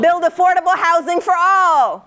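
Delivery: rally the crowd with each prompt; shouted prompts. Shown as a demonstration. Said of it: "calling out prompts to audience".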